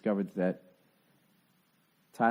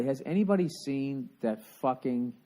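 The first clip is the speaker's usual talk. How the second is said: ordinary delivery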